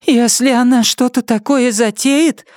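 a hint of Nettie's determination; lightly voiced Nettie. A narrator's reading. Woman steeling herself against her enemy.

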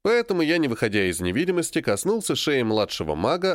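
ordinary delivery

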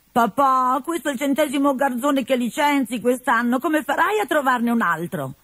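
put-on high, light girl's voice; young Colombina. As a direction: chide her father gently, voice up high. Colombina speaks to father.

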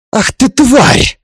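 slow, high, loud snarl; furious snarl. Acted. Father-in-law about to strike daughter-in-law, furious snarl. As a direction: spit the insult out with drawn-out fury. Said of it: "Зарычал он, полный злости".

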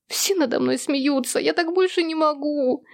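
high, despairing wail; crying. A narrator's reading. Daughter at breaking point before her father, crying. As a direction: wail as if unable to bear it. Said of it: "плакала Луи".

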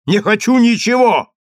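loud, high-pitched outburst; shouted refusal. A narrator's reading. Daniil rejects the rabbi's bribe, shouted refusal. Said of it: "закричал Даниил".

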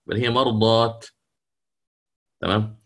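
usual speaking voice

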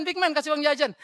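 put-on high, loud excited call of an older woman; mimicking Mami calling. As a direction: shout it like a fond auntie spotting you. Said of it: "panggil saya". (menirukan suara Mami memanggil dengan nada tinggi)

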